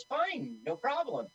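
high-pitched mock agreeableness; passive-aggressive. Director brushing off the actor, passive-aggressive. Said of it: "what a passive-aggressive asshole".

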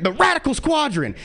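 ordinary delivery

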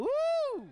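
loud high-pitched whoop; high woo. Real, not acted. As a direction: let out a sudden party whoop. Testing the mic, whoops.

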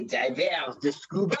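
shouted mock French "Divers de scuba"; mock French accent. Friend trying a French version of the joke, mock French. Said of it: "Can I say".

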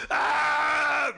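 high loud shriek; terrified falling yell; panicked scream. Retold or imitated. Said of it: "I'd be like".

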